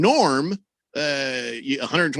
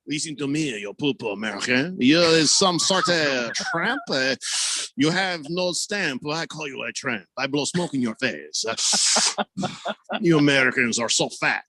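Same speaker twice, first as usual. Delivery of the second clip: a hint of a mocking French accent. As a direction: play a snooty Frenchman, lightly. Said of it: "The French accent is".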